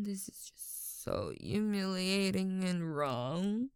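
slow, breathy whisper of shame; ashamed whisper. Acted. To herself in shame, a slow whisper. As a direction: murmur it to yourself, mortified. Ashamed after a humiliating act.

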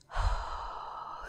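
soft unvoiced exhale through a smile; smiling exhale. Shown as a demonstration. Showing how a smile changes breathing, soft smiling exhale. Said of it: "it's more like a different vowel".

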